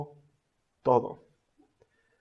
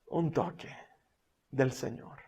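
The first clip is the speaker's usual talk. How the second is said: hushed; 5 semitones lower, 12 dB quieter than usual. Real, not acted. Repeating key phrase softly.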